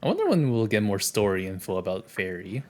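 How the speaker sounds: ordinary talk, nothing marked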